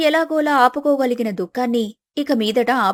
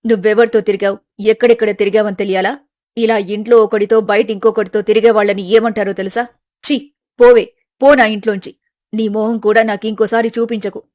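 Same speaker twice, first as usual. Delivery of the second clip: furious mother; 2 semitones lower, 5 dB louder than usual. Acted. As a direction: drive the daughter out of the house. Mother throws daughter out.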